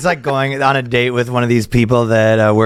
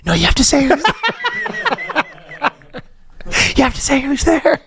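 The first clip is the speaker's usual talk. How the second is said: high, strained mock scolding; hammed-up wacky insistence; 13 semitones higher than usual. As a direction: insist like a goofy clown boss.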